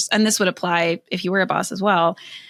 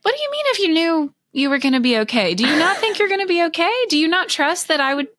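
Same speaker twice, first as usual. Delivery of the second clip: lightly voiced divine challenge, raised pitch, pressing questions; voice of the Holy Spirit; 9 semitones higher than usual. Retelling a moment of conviction to the host, a touch of a questioning divine voice. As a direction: gently confront her fear as if from God. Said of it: "the Holy Spirit convicted me".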